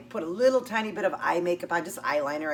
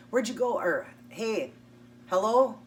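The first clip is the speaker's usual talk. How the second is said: higher-pitched, insistent calling after someone; mock impatient "hello?", growing louder and higher; persistent; 4 semitones higher, 4 dB louder than usual. Imitating his messages when she came back, persistent. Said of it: "He just was so persistent".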